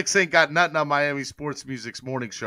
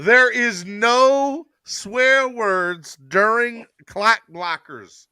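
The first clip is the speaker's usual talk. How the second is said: slow, emphatic rule-giving, pitched high; mock-stern announcement; 5 semitones higher, 6 dB louder, much slower than usual. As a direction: lay down the law, drawing out each word. Lays down show's swear rules.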